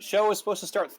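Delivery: ordinary talk, nothing marked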